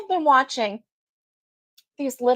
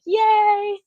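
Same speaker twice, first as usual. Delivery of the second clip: imitating viewer's cheer; 11 semitones higher, 4 dB louder than usual. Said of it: "you're gonna be like".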